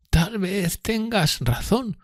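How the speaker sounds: high, very slow, hesitant concession; conceding Miss Havisham. Acted. Miss Havisham answering Pip, high and slow. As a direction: concede it slowly in a high character voice.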